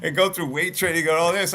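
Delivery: loud, high, laughing excitement; laughing excitedly. Real, not acted. Talk show host laughing loudly about linemen blasting each other. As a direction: laugh it up as you hype the big hitters.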